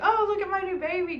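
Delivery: high, bright gushing over a new doll; excited squeal. Retold or imitated. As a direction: squeal it like showing off a treasure. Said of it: "I would be like".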